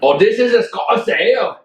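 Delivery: a goofy stab at a Scottish brogue; mock Scottish accent. Asked to do the accent, a mock Scottish accent. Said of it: "And you gotta do the accent".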